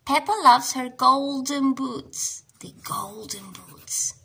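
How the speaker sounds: plain reading voice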